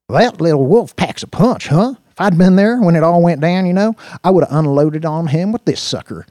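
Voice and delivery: loud, high boastful deputy; swaggering Brick. Acted. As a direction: brag loudly about your firepower.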